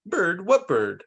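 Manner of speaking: ordinary delivery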